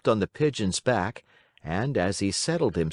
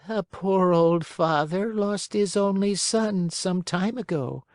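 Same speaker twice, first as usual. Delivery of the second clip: put-on high-pitched old woman telling sad news; little old woman; 11 semitones higher than usual. Old woman tells Pinocchio of a lost father, high sorrowful voice.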